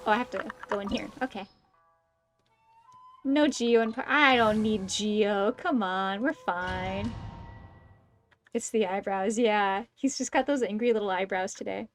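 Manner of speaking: normal talking voice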